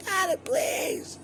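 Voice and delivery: very high whispered begging; hammed-up girlfriend voice, way up high and unvoiced; breathy girlish plea. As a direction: squeak it out in a tiny mock-girly whisper. Voicing girlfriend in bed.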